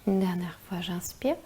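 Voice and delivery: soft whispered breathing guidance; slow, hushed meditation voice; guided meditation. Acted.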